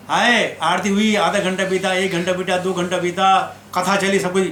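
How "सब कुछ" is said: normal talking voice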